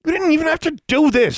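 high, loud angry snarl. A narrator's reading. Alexandra snarls at Larry, angry. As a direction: snarl it at him. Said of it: "she snarled".

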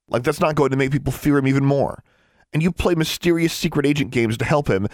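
high-pitched accusing questions; heated tirade. A narrator's reading. Sister berating brother over their father, heated.

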